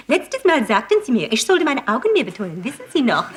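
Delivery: lightly bright German advert read; German cosmetics commercial voice; German Avon lady ad. Retold or imitated. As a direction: sell eyeshadow in German like a TV ad. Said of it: "I was on German television saying".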